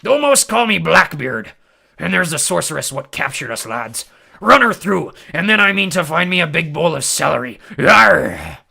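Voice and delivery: rally the lads like a roaring pirate; pirate captain. A narrator's reading. Pirate captain rallies his men.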